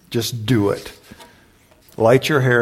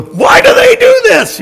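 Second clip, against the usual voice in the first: high, loud frustrated outburst; angry rant; 11 dB louder than usual. Retold or imitated. Past self ranting at school forms, loud and angry. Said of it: "that's when I still had my anger issues".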